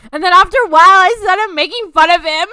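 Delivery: ordinary talk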